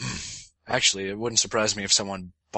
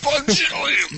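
squeaky high; 5 dB louder, slower than usual. Pretend. Joking over huge sales figure.

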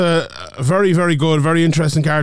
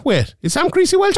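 high startled double take; sudden surprise; 14 semitones higher than usual. Real, not acted. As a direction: pitch jumps way up in a startled question. Sudden doubt mid-preview.

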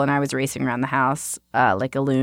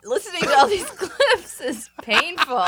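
high, giggling through the words; laughing in embarrassment at herself; 9 semitones higher than usual. Real, not acted. Host laughing at her own old clips, giggly and high.

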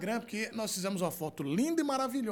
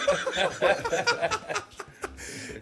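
airy high-pitched laugh; high breathy laugh; 12 semitones higher, 4 dB louder than usual. Real, not acted. Host laughs aloud.

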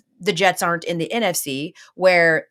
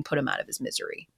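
whispered aside, much softer; mock mercy plea; 5 semitones lower, 10 dB quieter, faster than usual. Host mocking Zach Wilson's struggles in a soft whisper. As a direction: breathe it out like a pitying aside.

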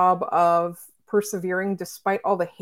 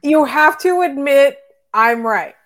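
slow, loud and insistent; 6 semitones higher, 8 dB louder, slower than usual. Real, not acted. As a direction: drag it out, demanding agreement. Teasing insistence on being right.

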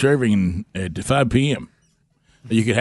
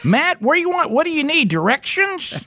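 much higher, sing-song helper voice asking where to go; mock navigation voice; 12 semitones higher than usual. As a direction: play a chirpy GPS assistant. Host mimics GPS voice for caller.

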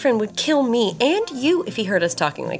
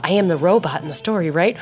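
normal talking voice

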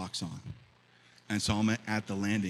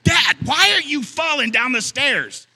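put-on angry kid shout; 14 dB louder than usual. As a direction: yell at Dad to hurry up. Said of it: "he got mad at me".

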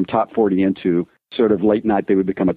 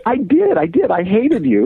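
much higher, a bit louder, eager admission; gleeful confession; 12 semitones higher, 3 dB louder than usual. Retold or imitated. Retelling meeting Rod Roddy, a high gleeful admission. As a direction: blurt it out with cheeky delight.

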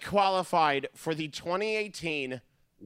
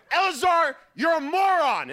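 high, loud exasperated yell; mom yelling; 10 semitones higher, 7 dB louder than usual. Retold or imitated. Mom yelling at dad after the long walk, high and loud. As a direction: snap at him like a fed-up wife. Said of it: "all of a sudden she goes".

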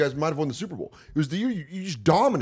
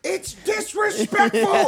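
high, slow, outraged mock shout; imitated yelling; 4 dB louder, slower than usual. Fans heckling kneeling players during anthem, mock yelling. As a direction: holler it, stretching the words out. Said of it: "what they were yelling".

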